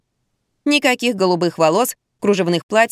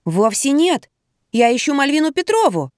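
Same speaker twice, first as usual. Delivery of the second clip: mildly indignant, higher-pitched protest; 6 semitones higher than usual. A narrator's reading. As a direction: object with a touch of indignation. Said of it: "возмутилась я". (с лёгким возмущением, повысив тон)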